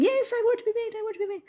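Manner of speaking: tiny, squeaky-high little creature; high-pitched creature voice. Acted. Wee creatures pleading with their maker, very high-pitched voice. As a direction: pipe up like an eager wee creature. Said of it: "They've got very high-pitched voice".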